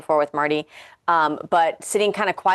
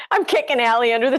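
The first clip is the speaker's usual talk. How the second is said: higher voice, laughing through the words; 8 semitones higher than usual. Real, not acted. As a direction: confess it through real giggles. Cracking up over shared story.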